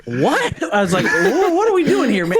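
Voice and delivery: high, incredulous, half-laughing; stunned he would start this; retold bafflement. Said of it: "And I was like".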